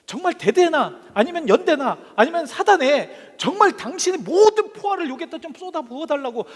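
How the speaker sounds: loud, high battlefield plea; shouted pleading. Acted. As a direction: implore headquarters at full voice. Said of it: "소리치고 간구하고". (소리치며 간구하는 흉내)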